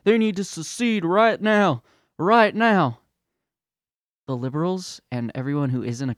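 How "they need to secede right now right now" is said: mock angry patriot. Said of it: "then you have 'em being like".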